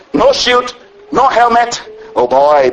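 normal talking voice

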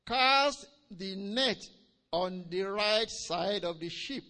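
loud, slow, drawn-out scripture command; proclaimed command. Retold or imitated. Jesus directing the fishermen, proclaimed command. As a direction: proclaim it weightily, word by word.

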